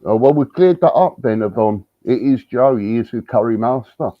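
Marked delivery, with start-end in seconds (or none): none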